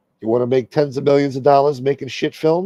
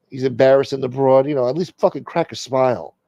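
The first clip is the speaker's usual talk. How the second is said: ordinary talk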